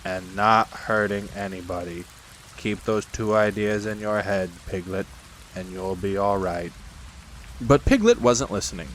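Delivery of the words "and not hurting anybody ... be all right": low, gloomy donkey voice; Eeyore instructing. Acted. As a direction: drone it low and evenly. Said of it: "said Eeyore".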